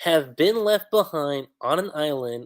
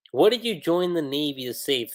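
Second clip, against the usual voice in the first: usual speaking voice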